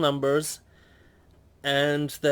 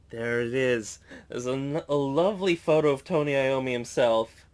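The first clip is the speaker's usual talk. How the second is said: normal talking voice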